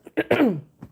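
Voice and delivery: short high loud laugh; sudden loud laugh breaking out; burst of laughter. Real, not acted. Laughs at own slip.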